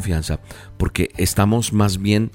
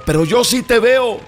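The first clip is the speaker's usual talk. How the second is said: high, drawn-out urging shouts; father shouting reassurance; slower than usual. A narrator's reading. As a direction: call up insistently so the boy trusts you. (padre que grita para dar confianza)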